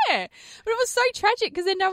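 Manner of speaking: squeaky laughing yeah; a real high laughing yeah; high pitched. Real, not acted. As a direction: pop it up high through a laugh. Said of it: "you went very high pitched".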